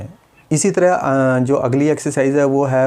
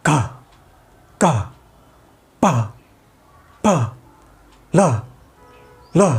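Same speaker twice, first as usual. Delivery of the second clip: each syllable pushed out with a sharp burst of air; explosive burst; slower than usual. Shown as a demonstration. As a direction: spit every consonant out in one jolt. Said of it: "पूरे झटके से बाहर air को बाहर निकालना". (पूरे झटके से हवा के साथ बोलना)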